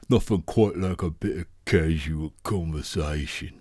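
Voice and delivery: a fuller put-on Australian accent; mock Australian accent. Shown as a demonstration. Friends goofing with an Australian accent on the catchphrase. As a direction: lean into the Aussie twang. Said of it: "It's Australian, sounds Australian".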